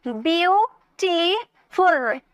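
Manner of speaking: ब्यूटीफुल slowly in three beats, ब्यू, टी, फुल; split into syllables. Shown as a demonstration. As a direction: pause after each stretched syllable of ब्यूटीफुल. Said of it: "तीन syllable". (ब्यूटीफुल को तीन हिस्सों में धीरे तोड़कर)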